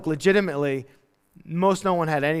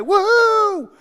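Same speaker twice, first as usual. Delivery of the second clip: much higher whoop; cheer; 12 semitones higher, 5 dB louder than usual. Acted. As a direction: whoop it up in celebration. Celebrating low resources.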